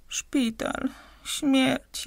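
a mild moaned lament of dread; moaning. A narrator's reading. Sick woman lamenting hospital and death, mild moan. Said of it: "jęknęła chora".

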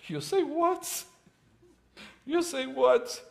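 high-pitched incredulous squeak; stunned disbelief. Acted. Gideon hearing God's order to shrink his army, stunned and high. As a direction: sound floored by the order.

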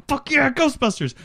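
high, gleeful cheer; excited fanboy cheer. Retold or imitated. Fan cheering at the Ghostbusters title. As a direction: whoop like a thrilled fan. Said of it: "there's a part of me that's just like".

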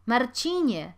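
loud call across the yard. Acted. Woman calling the cooper across the yard, loud call. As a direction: call his name across the courtyard. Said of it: "zawołała do bednarza kobieta".

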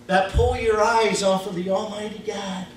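usual speaking voice

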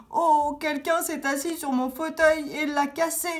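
high, wailing little bear; petit ours en pleurs. A narrator's reading. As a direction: cry it out in a tiny high voice. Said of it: "s'exclama petit ours en pleurant". (ourson qui pleurniche d'une voix aiguë)

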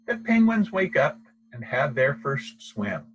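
plain reading voice

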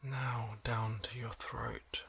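soft, slow relaxation voice with long pauses; hushed, unhurried body-scan meditation; guided meditation. Acted. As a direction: gently guide the listener into calm, leaving space between phrases.

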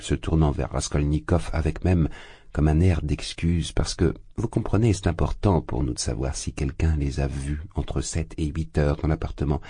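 ordinary delivery

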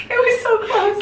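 laughing. Real, not acted. Laughing about near toilet trip.

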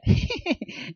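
high, breathy, humorless chuckle; nervous laugh. Acted. Luke laughing back at Nina, nervous and forced. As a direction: laugh nervously to cover the lie. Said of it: "riendo nerviosamente y sin gracia".

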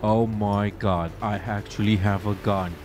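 low, drawn-out amazement; awestruck. Real, not acted. Player discovers they actually have a gun, awestruck. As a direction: let it sink in slowly, pitched low.

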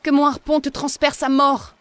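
un cri plus aigu et bien plus fort; hurle-le avec ses dernières forces; cri de guerre. A narrator's reading. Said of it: "il cria". (cri puissant du chasseur blessé)

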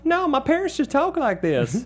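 high put-on child voice with a Southern accent; toddler voice with Southern accent. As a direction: play the little daughter answering in a Southern accent. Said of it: "So they're always like".

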